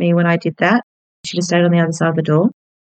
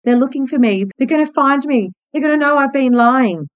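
paranoid fear; 8 semitones higher than usual. Retold or imitated. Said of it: "the paranoia would be based on that guilt".

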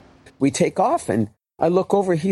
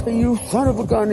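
mock angry outburst, pitched up; mock cursing; 6 semitones higher than usual. Erratic driver cursing his hitchhiker, mock angry. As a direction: imitate a driver suddenly cursing. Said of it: "He starts cursing me again".

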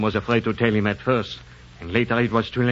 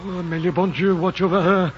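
normal talking voice

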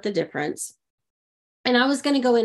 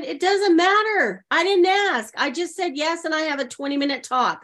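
excited retort; 7 semitones higher than usual. Retold or imitated. Retelling her own carefree answer.

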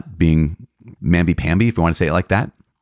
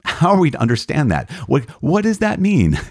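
mock bewildered question; 9 semitones higher than usual. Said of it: "the catechism asks the question".

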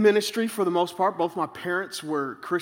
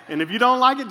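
ordinary talk, nothing marked

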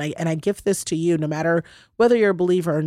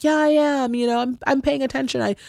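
higher mock-defensive husband voice; hammed-up protesting partner, pitched up; imitating husband; 7 semitones higher than usual. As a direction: play the husband insisting he's listening. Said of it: "He's like".

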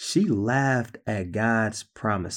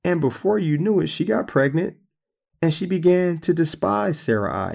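ordinary talk

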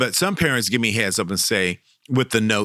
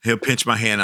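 ordinary talk, nothing marked

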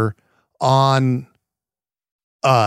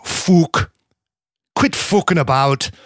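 put-on Dublin accent, rough and punchy; imitating a Dubliner telling someone off; 3 semitones higher, slower than usual. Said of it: "the Dublin, you know, they're saying".

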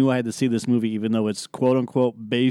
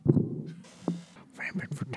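whispering; 3 semitones higher, 9 dB quieter, much slower than usual. Pretend. Playful repeat of the phrase.

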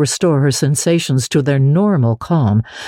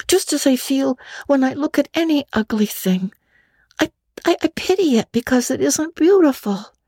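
put-on high, quick young girl voice; a chattering imaginative orphan girl; Anne, girlish and eager; 10 semitones higher than usual. Anne complains to Marilla, high girlish voice. Anne's voice.

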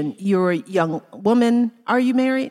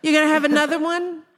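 fast, higher mock pester; nosy busybody; 6 semitones higher, much faster than usual. Mocking those who quiz a new mother, fast and high. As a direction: rattle off the prying questions.

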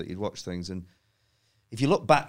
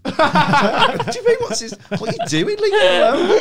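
much higher and louder, laughing incredulity; mock outraged; 9 dB louder than usual. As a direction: yell it in disbelief, half laughing. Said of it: "I'm like, outraged quote".

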